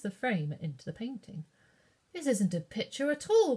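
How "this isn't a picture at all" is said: put-on higher, louder astonished child; astonished cry. A narrator's reading. Katie finds the painting real, crying out astonished. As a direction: let the wonder burst out. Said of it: "cried Katie, astonished".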